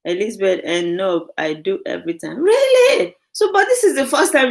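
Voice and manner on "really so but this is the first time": much higher, squealed "really?"; surprised. Real, not acted. Host reading a viewer's comment, surprised squeal. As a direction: shoot up in happy disbelief.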